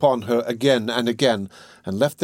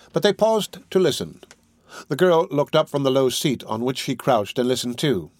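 ordinary delivery

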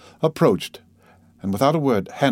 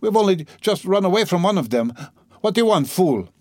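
higher-pitched, indignant woman; mildly frantic indignation, pitched up; agitated gentlewoman; 8 semitones higher than usual. Acted. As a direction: play an affronted lady turning on an officer.

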